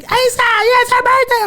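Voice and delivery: high mock wife voice; high-pitched bubbly wife impression; quoting wife. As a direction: imitate her bubbly intro. Wife introducing birthday girl.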